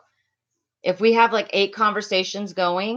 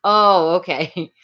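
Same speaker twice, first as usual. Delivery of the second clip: ordinary talk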